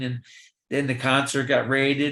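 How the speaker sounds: ordinary talk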